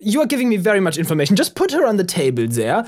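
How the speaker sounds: put-on high, quick German-accented orders; German doctor. German doctor ordering the sheriff about, high German accent. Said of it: "The German doctor".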